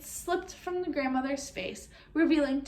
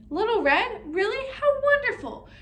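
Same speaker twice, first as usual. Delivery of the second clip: high, loud, thrilled exclamations; delighted grandmother; 8 semitones higher, 4 dB louder than usual. A narrator's reading. Grandmother hearing Little Red at the door, delighted. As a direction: rise higher with each delighted phrase.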